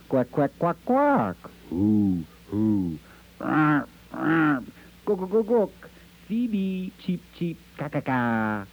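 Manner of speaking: high chirping squawks, one bird after another; bird calls. Acted. Birds of America answer with high bird calls. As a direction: call out like a flock of ducks, owls and songbirds. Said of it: "said the birds of America".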